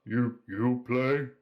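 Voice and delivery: slow, halting big tavern regular; lightly voiced half-troll, halting over the words; hesitant half-troll. Half-troll leaning over to a newcomer, hesitant. Game master voices Hork.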